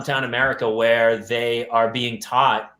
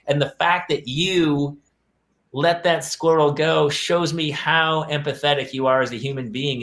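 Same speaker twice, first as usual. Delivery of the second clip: ordinary talk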